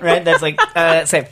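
ordinary talk

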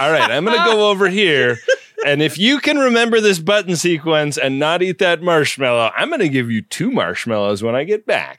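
laughing through a stern Seven of Nine impression. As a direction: play a strict android giving instructions. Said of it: "she's like".

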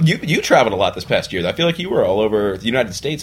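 usual speaking voice

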